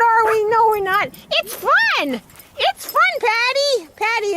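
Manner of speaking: high, bright pet-cheering voice; fake cheerful. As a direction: sound excited so the dogs aren't scared. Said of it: "you're supposed to make it sound like it's fun".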